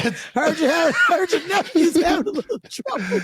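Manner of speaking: high, needling call; playful high jeer; mock heckler taunt. Repeating the other man's heckle.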